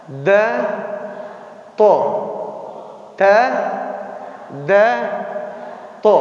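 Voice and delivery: ta, da, to repeated in sets with pauses; letter drill. Shown as a demonstration. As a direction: model the three letters in turn. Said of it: "Tapi dia kena".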